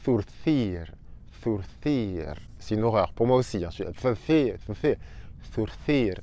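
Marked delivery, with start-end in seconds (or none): 0.0-2.4 zurcir with both z and c said as th; Spanish zurcir. Shown as a demonstration. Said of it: "Autre mot difficile". 4.0-6.2 zurcir with both z and c said as th; Spanish zurcir. Shown as a demonstration. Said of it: "La langue doit sortir à chaque fois".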